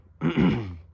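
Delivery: sharp loud throat clear. Real, not acted.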